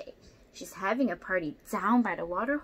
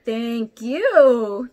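much louder big elephant thanks; trumpeting elephant; 16 dB louder than usual. Acted. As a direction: boom it like an elephant. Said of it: "trumpets Elephant".

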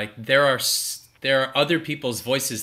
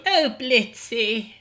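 slow, high character voice; 10 semitones higher, much slower than usual. Shown as a demonstration. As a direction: drawl the key phrase to find the voice. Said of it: "I'll just go".

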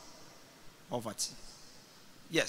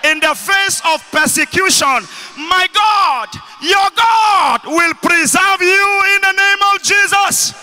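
loud, high shouted declaration; rousing preacher's shout, pressing for an amen; full-force preaching; 5 semitones higher, 9 dB louder than usual. Real, not acted. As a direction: decree it over the congregation at full voice. Declares blessing over congregation.

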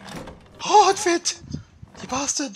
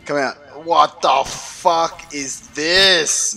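usual speaking voice

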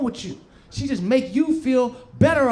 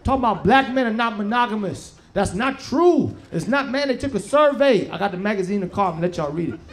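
ordinary talk, nothing marked

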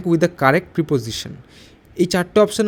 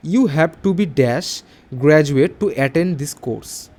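slow dictation read; 2 semitones lower, much slower than usual. Shown as a demonstration. Teacher reads out exercise sentence.

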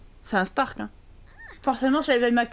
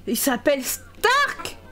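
surprised repeat; slower than usual. Real, not acted. Streamer repeating the name Stark back in disbelief, astonished. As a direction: stretch it out and burst on "Stark" in disbelief.

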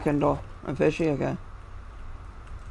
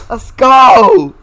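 louder, drawn-out victory cheer; triumphant yell; 3 semitones higher, 5 dB louder than usual. Real, not acted. Player celebrating hitting the shot, triumphant yell. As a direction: let it rip in celebration.

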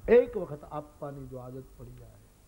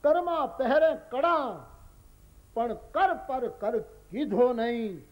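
slow, higher, louder recitation of "Karma પહેરે કડા"; recited couplet; 6 semitones higher, 3 dB louder, slower than usual. Shown as a demonstration. Preacher reciting the folk couplet to the congregation, slow and declaimed. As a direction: declaim the verse slowly. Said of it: "હાચું હોરઠિયો ભણે".

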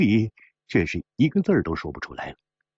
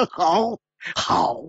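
hearty laughter; 8 semitones higher than usual. Acted. Gambler delighted by a rival's wager, laughing heartily. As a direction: laugh out in beats, then high approving words.

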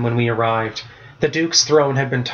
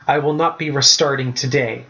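ordinary delivery

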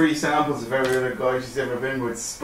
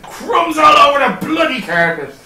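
imitating a friend; 10 semitones higher, 4 dB louder than usual. Quoting a friend's catchphrase.

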